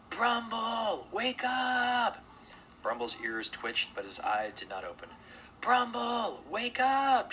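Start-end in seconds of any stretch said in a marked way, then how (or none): 0.1-2.2 high, drawn-out shout; biggest voice. A narrator's reading. Mona calls to wake the sleeping bear, big high shout. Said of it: "in her biggest voice". 5.6-7.3 high, loud drawn-out call; big wake-up shout. A narrator's reading. Mona tries to wake snoring Brumble, loud high shout. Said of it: "she tried again".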